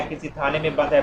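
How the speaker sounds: usual speaking voice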